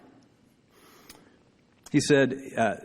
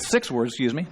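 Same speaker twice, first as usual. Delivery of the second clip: ordinary talk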